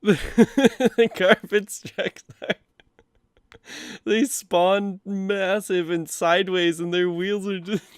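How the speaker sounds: high, slow words broken by laughter; laughing while talking. Real, not acted. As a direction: barely get it out through giggles. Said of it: "I'm gonna kill my voice just from laughing".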